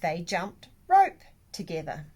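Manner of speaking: ordinary delivery, nothing marked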